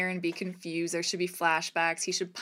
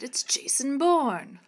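higher, slow drawn-out punchline; mock dramatic reveal; 7 semitones higher, 5 dB louder, slower than usual. Host topping the game line, theatrical. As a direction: stretch out Bourne dramatically.

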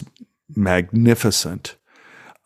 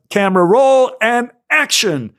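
much higher call of the cue; calling action; 10 semitones higher than usual. Retold or imitated. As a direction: call the roll to the whole set. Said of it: "I'm hearing the A D on the other side".

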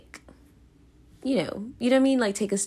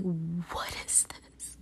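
much softer, slow whisper of confusion; bewildered whisper; 11 dB quieter, much slower than usual. Retold or imitated. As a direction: breathe it out, lost and puzzled. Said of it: "I'm just like".

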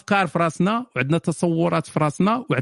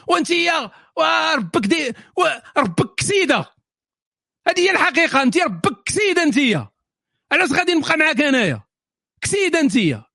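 mock insulting yell; 8 semitones higher than usual. Man mocking a harsh breakup, angry shout. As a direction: hurl insults like a brute dumping her.

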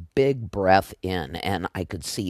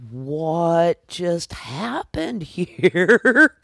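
bewildered; 7 semitones higher, 4 dB louder, much slower than usual. Retold or imitated. Waking from vision bewildered.

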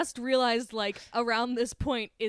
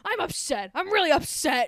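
hammed-up Brooklyn accent, building louder and higher; imitated Brooklyn voice; 4 semitones higher, 4 dB louder than usual. Upset Brooklyn friend protesting, hammed-up Brooklyn accent. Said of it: "extremely Brooklynite man".

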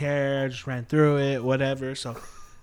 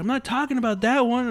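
higher, insistent correction; mock protest; 7 semitones higher than usual. Retelling a customer correcting the clerk about the game, mock protest. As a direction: imitate someone annoyed at being misunderstood.

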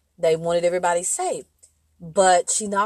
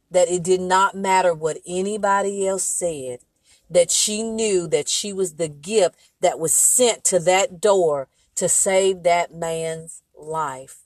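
usual speaking voice

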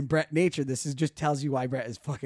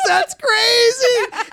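much higher, very slow, crying out; crying shout; 12 dB louder, much slower than usual. Retold or imitated. Friend hearing the news, crying out happily. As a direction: shout it through happy tears. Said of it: "He goes".

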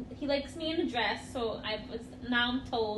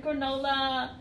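ordinary talk

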